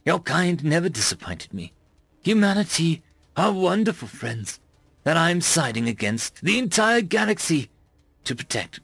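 earnest alien friend. A narrator's reading. Tarver's heartfelt confession.

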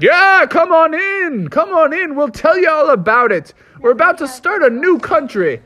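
high, jolly group voice; proud old patriots welcoming him; founding fathers voice. Acted. Founding fathers cheerfully greet the owner in a high voice.

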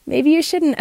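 high, slow voice of self-doubt; doubting little voice. Retold or imitated. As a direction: whisper doubts like a nagging inner voice. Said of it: "that little voice comes up and says".

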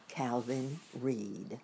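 plain reading voice

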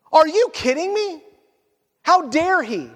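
high-pitched affronted outburst; indignant. Acted. Pharisees realizing the parable is about them, indignant. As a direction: act out offended disbelief.

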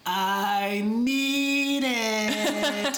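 long, high-pitched desperate wail; hammed-up cartoon desperation; SpongeBob. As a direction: stretch each word out in a parched cartoon plea. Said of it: "So he's just like".

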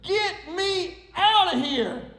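high, drawn-out cry for rescue; hammed-up desperate yell; acted scream. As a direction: scream slowly to be let out, half in earnest. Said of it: "I screamed as loud as I could".

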